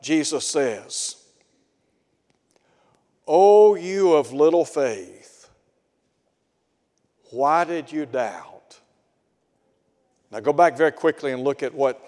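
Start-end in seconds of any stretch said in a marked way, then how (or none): 3.3-8.8 gentle rebuke. Acted. Preacher voicing Jesus' gentle rebuke.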